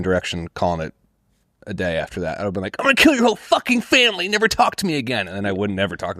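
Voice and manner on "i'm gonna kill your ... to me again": high, strained yell at a former friend; mock furious threat. Teen comic imagines threatening his friend in a high mock fury. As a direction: blow up like a wounded fifteen-year-old.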